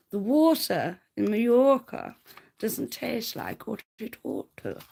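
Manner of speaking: correcting herself into a mock posh advert read. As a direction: redo the old advert line properly, savouring it. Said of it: "No, no, no, darling".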